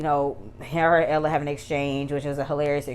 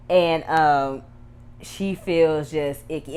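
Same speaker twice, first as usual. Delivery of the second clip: normal talking voice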